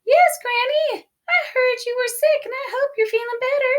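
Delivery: put-on wolf squeaks high to pass as the girl; wolf faking girl's voice. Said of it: "made his voice sound like".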